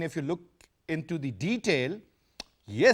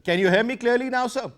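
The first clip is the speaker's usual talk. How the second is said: louder, higher, for a bad line; to someone hard of hearing; 6 semitones higher, 4 dB louder than usual. Real, not acted. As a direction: raise your voice so he hears. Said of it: "speak louder, please".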